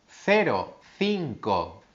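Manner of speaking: "cero" and "cinco" with a lisped th sound; careful teacher demo of Castilian c; soft c demo. Shown as a demonstration. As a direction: put the tongue between the teeth for c. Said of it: "se pronuncia así".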